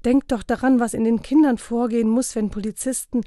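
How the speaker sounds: urgent, high appeal, cut off at the end; pleading. A narrator's reading. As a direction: plead with him to think. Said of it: "bat sie".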